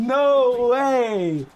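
stunned disbelief. Real, not acted. Shocked at a wonder goal.

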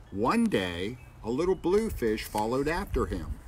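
storybook reading voice; reading to children. A narrator's reading. Reads picture book aloud.

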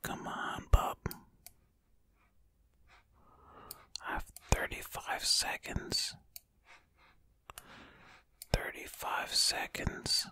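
hushed running commentary; ASMR whisper. Acted. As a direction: whisper close and intimate while solving. Whispered ASMR puzzle play.

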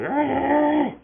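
long imitated dog growl; drawn-out mock growl.

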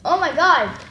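loud, thrilled outburst; excited shouting. Real, not acted. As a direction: shout in sudden delight. First Pop Rocks popping in mouth.

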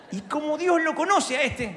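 ordinary talk

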